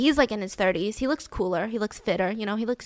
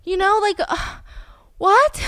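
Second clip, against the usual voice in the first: stunned disbelief; 10 semitones higher, 5 dB louder, slower than usual. Real, not acted.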